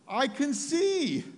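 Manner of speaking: high, loud, drawn-out exclamation of wonder; retold amazed cry. Preacher voicing the healed woman's discovery, amazed cry. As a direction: imitate her astonished discovery at full voice.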